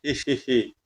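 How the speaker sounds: low, soft, sly witch giggle; evil cackle. Acted. Girl gloats over her curse with a soft evil cackle. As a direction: snicker under the breath like a gloating witch.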